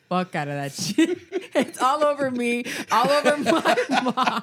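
telling it through rising laughter; real laughing. Real, not acted. Telling friends about kicking sauce over mom, laughing. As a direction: crack up as you get the words out.

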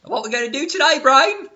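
high, loud cartoon mouse voice; high loud toon mouse; Pinky impression. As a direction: squeak it out loudly. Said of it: "Pinky and the Brain".

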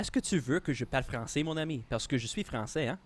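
softly spoken Quebec French; Quebec French accent. Shown as a demonstration. Showing off French to co-hosts, in a Quebec accent. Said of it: "that's a Quebec accent".

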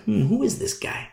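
high, soft, puzzled rise; suspicious wonder. Acted. Leaders eyeing Paul, softly suspicious. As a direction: quietly wonder who he is. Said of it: "religious leaders like".

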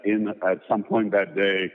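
normal talking voice

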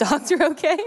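real laugh breaking into the words. Real, not acted. Preacher admits failing the one dog-sitting job, laughing. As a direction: confess the blunder through laughter.

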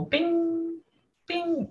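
high, soft chiming bing; ding like a little bell; chime sound. Pretend. Sound effect.

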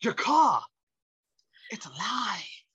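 odd halting Walken voice, fading to a hush; a spooky Walken murmur; a dazed celebrity voice; imitating Christopher Walken. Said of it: "Mister Walken ... goes".

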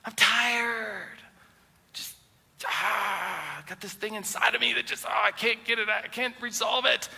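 high, strained venting, held in; acted exasperated self-excuse; frustrated. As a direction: vent pent-up exasperation without shouting. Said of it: "I'm just frustrated".

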